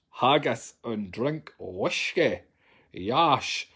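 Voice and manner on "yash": ordinary talk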